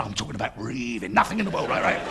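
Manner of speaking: usual speaking voice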